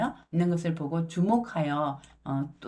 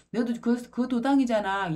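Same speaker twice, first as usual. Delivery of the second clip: higher, pointed accusing call; accusing bystander; 7 semitones higher, 3 dB louder than usual. Acted. Bystanders to Peter, higher accusing voice. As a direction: catch him out in front of everyone.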